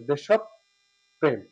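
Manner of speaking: দেশপ্রেম very slowly as "desh-ob-prem"; correct pronunciation. Shown as a demonstration. Teacher spells out the correct form for students, slow. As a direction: draw দেশপ্রেম out slowly with doubled p. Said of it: "সঠিক উচ্চারণ হচ্ছে".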